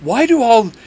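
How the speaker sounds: loud, high-pitched indignant outburst; exasperated snap. A narrator's reading. Dean snaps at the monsters about his brother, exasperated. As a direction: snap back, offended and loud. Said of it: "Dean snaps".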